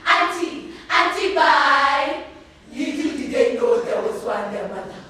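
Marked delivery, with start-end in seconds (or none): 0.1-2.2 higher calling voice, drawn out on bye; mock children's farewell shout. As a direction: call goodbye like excited cousins from a departing bus. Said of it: "my name they shout".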